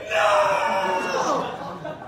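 loud protesting cry; shouted no. Retold or imitated. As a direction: yell it in dismay. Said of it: "And I was like".

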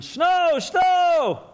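put-on very high, loud screaming kid; frantic kid yell. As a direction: scream it while flailing about. Running around beating his arms.

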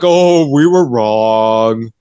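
slow, drawn-out mocking quote; mock contrition. Imitating child services backing down, mock contrition. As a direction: drag the words out as a dopey admission.

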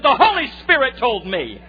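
high, booming retold declaration; emphatic proclamation. Preacher voices the driver answering the stunned hitchhiker, emphatic proclamation. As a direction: proclaim it loudly like a revelation. Driver's triumphant reply, acted.

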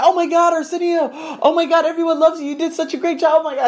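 mock a fawning boss; gushing boss.

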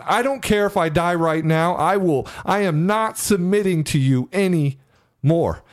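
high, strained defiant yell at the panic; screaming defiance. Retold or imitated. Man standing on his bed yelling at his panic attack, high defiant yell. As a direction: scream it out, daring the fear to come. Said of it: "I start screaming".

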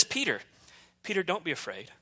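soft, hushed Jesus comforting Peter; a quiet, tender call to calm his fear; gentle reassurance. Acted.

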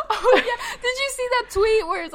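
laughing. Real, not acted. Remembering a funny tweet with her co-host, laughing. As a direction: talk while still cracking up. Cracking up at a joke.

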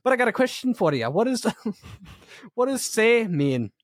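high-pitched mock Scottish accent; squeaky-high Scottish teacher imitation; Scottish professor. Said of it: "he might have been Scottish".

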